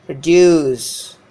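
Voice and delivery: produce with long, loud -DUCE; proDUCE, second syllable drawn out and falling; stress on second syllable. Shown as a demonstration. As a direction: hit -duce hard in produce. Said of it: "Here the second syllable is stressed".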